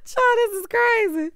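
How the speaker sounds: real laughter spilling over; laughing hard. Real, not acted. Cracking up about the maid excuse, laughing high.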